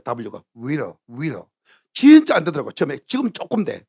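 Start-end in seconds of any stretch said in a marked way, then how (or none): 0.6-1.4 "will" said slowly with a rounded W; lower, softer "will" pronunciation demo; W sound practice. Shown as a demonstration. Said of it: "뭐가 안 되느냐면 또 W가 안 돼요".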